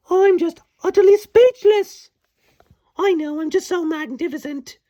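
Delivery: hammed-up high-pitched boasting; boastful Tink. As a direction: gloat as a smug tiny fairy. Boastful Tink monologue, shrill.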